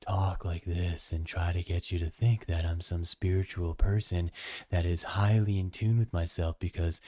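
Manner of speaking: mock serene yoga teacher murmur. Shown as a demonstration. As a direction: put on a hushed enlightened yoga voice. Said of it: "I don't have a yoga voice".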